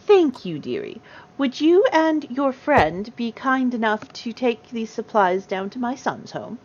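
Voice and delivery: put-on slow, lilting old lady request; kindly gnome grandmother. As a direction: ask sweetly like a granny. Grandmother asks shopkeeper a favour.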